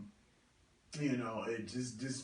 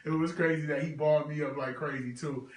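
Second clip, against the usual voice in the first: laughing; 3 semitones higher, 5 dB louder, faster than usual. Real, not acted. Brother on his live, laughing at memories of basketball.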